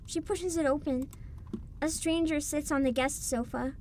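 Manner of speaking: calm, even narrator voice; story narration. A narrator's reading. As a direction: read it like a storybook. Reading game narration.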